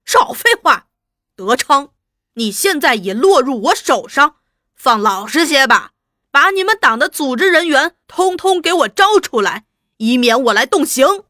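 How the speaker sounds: loud, menacing interrogator's demands; angry interrogation. Acted. Interrogator orders the prisoner to confess, angry and loud. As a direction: open on a high shout, then press loudly.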